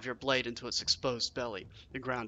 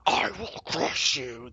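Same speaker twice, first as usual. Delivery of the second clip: a hint of a slow, gravelly rock serpent; lightly voiced menacing serpent; 3 dB louder, slower than usual. A narrator's reading. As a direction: threaten slowly like a stone beast. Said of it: "in gravelly tones".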